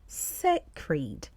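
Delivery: slow "sacred" said SAY-kreed, two clear syllables; "sacred" split into SAY and KREED; careful pronunciation. Shown as a demonstration. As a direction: model "sacred" as SAY-kreed for learners. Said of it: "the word we are focusing on".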